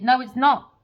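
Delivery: normal talking voice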